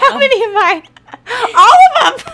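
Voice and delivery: high, loud laughing squeal. Real, not acted. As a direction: shriek it out through laughter. Joking about proposing to men.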